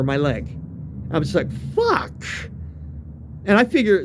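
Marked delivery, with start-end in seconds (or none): 1.7-2.2 high-pitched exasperated "fuck"; retold curse. Cursing, trying not to wake friends.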